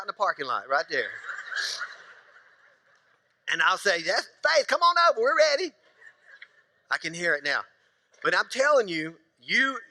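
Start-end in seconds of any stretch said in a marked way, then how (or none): 4.0-5.7 calling out. Retold or imitated. Dad calling out to daughter.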